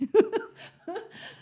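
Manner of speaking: short real laugh, pitched high. Real, not acted. Real laugh after joking about finally loving herself. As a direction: laugh it off with a wry edge. Laughs at self-deprecating point.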